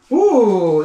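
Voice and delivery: usual speaking voice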